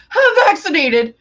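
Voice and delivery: high, loud, awkward blurt; mock scream. Friend mocks own awkward announcement, high loud blurt. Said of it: "scream in his face that I'm vaccinated".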